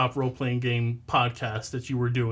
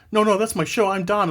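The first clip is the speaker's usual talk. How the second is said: protesting; 7 semitones higher, 5 dB louder than usual. Retold or imitated. Protesting the nickname Hexgrid.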